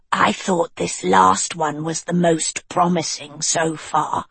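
put-on cool, level young witch; Annadil voice.

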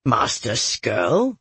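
slow, low scoff swinging up at the end. A narrator's reading. A Verenai dismissing talk of a manipulative master, scoffing. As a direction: draw the name out in disbelief. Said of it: "the other Verenai scoffed".

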